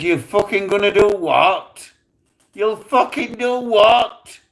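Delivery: high, loud, incredulous threat; retold angry shout, last words drawn out; furious challenge. Teller facing down the crew with a gun, furious challenge.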